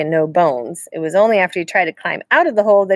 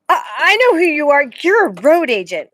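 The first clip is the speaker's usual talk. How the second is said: nervous accusing; 9 semitones higher, 5 dB louder than usual. A narrator's reading. Frightened boy confronts stranger.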